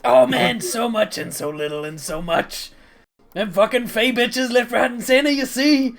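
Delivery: loud, animated bragging; boisterous, rambling Rock figure. Acted. Rock telling Ozav what he has been up to, boisterous ramble. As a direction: ramble loudly to an old pal. Game master plays Rock.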